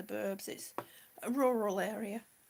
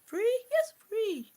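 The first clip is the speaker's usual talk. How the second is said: high pet-talk voice; 9 semitones higher, 8 dB louder than usual. Pretend. Greeting bird in high voice.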